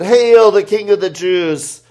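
high, loud mock acclamation; soldiers' loud hail. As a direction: proclaim it loudly, like jeering soldiers. Soldiers mocking Jesus, voiced in reading.

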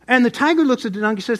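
ordinary delivery

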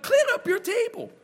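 high, mock-prim command; mock bossy order. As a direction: play the nagging voice. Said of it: "I'm like".

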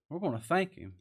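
usual speaking voice

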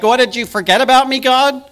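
high, loud, fast petulant whine; mock indignant complaint to God. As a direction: complain to God like a spoiled child. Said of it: "it's like".

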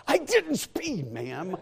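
high, slow whiny protest. Retold or imitated. Protesting to the court clerk, high and whiny. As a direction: drag it out like an indignant kid.

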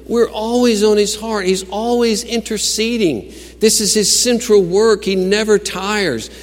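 high, driving sermon crescendo, easing later; preach. Acted. Preacher telling the congregation Christ always intercedes, high impassioned preaching. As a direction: proclaim it with mounting conviction.

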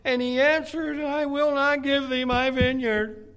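high, whimpering spoiled king; whiny Ahab. Acted. Ahab whining to Jezebel about the vineyard, high childish whine. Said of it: "And he said unto her".

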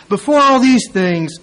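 forceful preaching of before all these things. Shown as a demonstration. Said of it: "repeated reading of verse".

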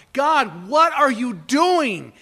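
loud, slow, high cry to God; slow, loud wail of why; anguished cry. Retold or imitated. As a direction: call out in bewildered pain. Job cries out to God.

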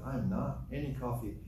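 usual speaking voice

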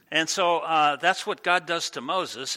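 ordinary delivery

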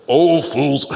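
loud, high mock scolding "Oh, fools"; mock harsh rebuke. Preacher mimics a harsh word to discouraged people, loud. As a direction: bark it like a blunt rebuke. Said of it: "Is that the first word to come out of your mouth".

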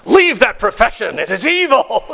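high-pitched, hammed-up denunciation; mock condemnation. Imagined John orders tax collector out, mock-stern outcry.

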